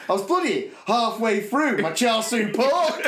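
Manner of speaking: laughing mock outrage. Mate on the phone, laughing in mock outrage. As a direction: blurt it through laughter. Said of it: "he went".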